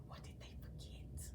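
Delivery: gasp, then a secret whisper. Acted. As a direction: lean in and whisper the mystery. Narrator teases listeners.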